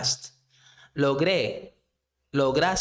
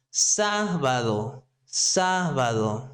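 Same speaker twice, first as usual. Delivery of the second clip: Sábado said slowly as SÁ-ba-do, first syllable stretched; stress on first syllable; slower than usual. Shown as a demonstration. Teacher shows learners the accent point, Sábado stressed slowly on SÁ. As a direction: lean long on the SÁ of Sábado, slowly. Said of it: "We need to respect the accent point".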